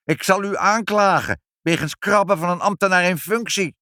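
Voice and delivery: high, loud indignant threat; furious shouting. A narrator's reading. Departing inspector threatens to press charges, furious shout. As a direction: call out in pompous acted rage. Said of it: "riep hij nog woedend".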